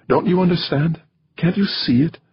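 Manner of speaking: high-pitched, exasperated plea; pleading. Acted. Woman begging her lover to understand, pleading. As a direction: plead with him, voice pitched right up.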